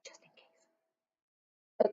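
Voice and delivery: slow hushed whisper. Acted. Reader whispers the secret plan to listening kids. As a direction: breathe it out like a secret.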